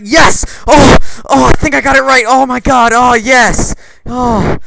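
high, loud, hammed-up climax cries; mock ecstatic moaning. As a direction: imitate someone wildly overexcited by a right answer. Mimics classmate's climax over exam answer.

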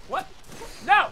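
ordinary delivery

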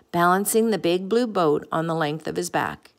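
ordinary delivery, nothing marked

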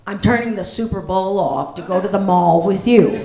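low, loud pull-string doll voice; a mechanical pull-string doll line; talking doll. Pretend. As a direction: play back like a talking doll.

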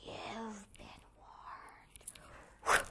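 soft whispered threat; whispered warning; menacing whisper. Acted. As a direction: breathe it as a threat. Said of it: "he said".

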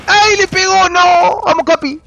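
high, loud shouts at the action; excited yelling. Real, not acted. Streamer urging Capi on mid-round, excited shouting. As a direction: holler at the hit and urge the player on.

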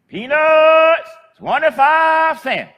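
high, loud drawn-out peddler's cry; street vendor call. Retold or imitated. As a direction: call it out like an old lady selling peanuts down the street. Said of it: "and she'd say".

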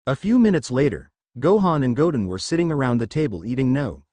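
ordinary delivery, nothing marked

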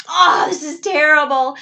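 angry again. Acted. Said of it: "Jonah gets angry again".

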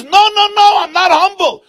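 high, loud desperate denial; panicked protest. Acted. Preacher frantically denies being humble. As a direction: shriek the no's to save himself.

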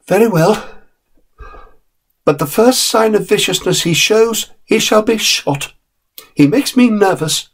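a worried mother laying down the law; nervous mother. Acted.

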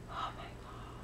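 hushed, breath-only swoon; barely audible, reverent gasp of admiration; awed whisper. Real, not acted. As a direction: whisper it, overcome by how lovely it is. Swooning over gifted yarn.

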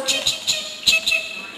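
high chirping bird call. Shown as a demonstration. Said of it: "You know, like that".

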